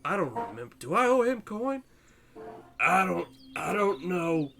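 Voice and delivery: put-on halting, confused drunk with wandering pitch; muddled drunk. Drunk priest puzzles over who owes coin, muddled refusal. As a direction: lose the thread like a befuddled drinker. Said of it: "He's just a drunk".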